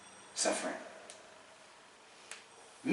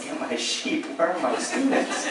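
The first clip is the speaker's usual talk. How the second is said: higher, louder mock shepherd calling his flock; 7 semitones higher, 4 dB louder than usual. Teacher rounding up his students, higher shepherd's call. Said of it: "a shepherd".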